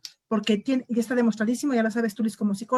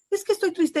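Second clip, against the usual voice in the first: mock sad child; 8 semitones higher than usual. Hijo imitado triste.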